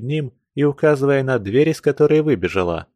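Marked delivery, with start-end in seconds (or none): none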